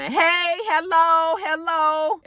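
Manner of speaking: high, drawn-out hello of a diva; put-on, high-pitched greeting; mimicked intrusive greeting. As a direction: imitate someone barging in with high hellos. Mimics Prima Donna butting in.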